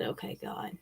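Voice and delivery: softly whispered words to God; a hushed, resigned prayer. Retold or imitated. Quiet prayer facing death.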